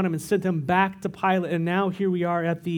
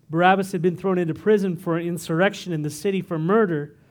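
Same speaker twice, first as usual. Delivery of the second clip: ordinary talk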